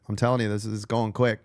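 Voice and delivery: ordinary delivery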